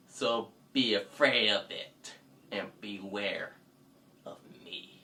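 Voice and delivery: low, fading to a whisper; spooky warning. Acted. Heroine threatens the bunny, low spooky whisper. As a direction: drop it low and eerie.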